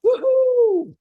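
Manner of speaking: a loud high whoop of joy; excited whoop. Retold or imitated. Recalling the Kung Fu Panda movie, a loud high whoop. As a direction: cheer it out like a fan in the crowd.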